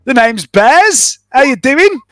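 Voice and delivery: a jovial trader sizing up a stranger; shopkeeper voice. Acted. Shopkeeper Bears introducing himself to the newcomer, high and slow.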